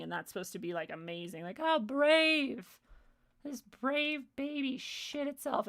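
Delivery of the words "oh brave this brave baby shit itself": sarcastic sing-song, higher and slowed; mock the fake admiration, dragging the words; mocking praise. Mocking people praising outbursts.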